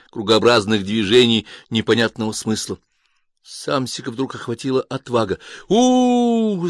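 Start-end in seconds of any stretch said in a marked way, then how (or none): 5.7-6.7 high ghostly hooting hum; spooky drone. A narrator's reading. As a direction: drone it like a spirit in the dark. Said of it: "загудел он". (протяжное гудение призрака)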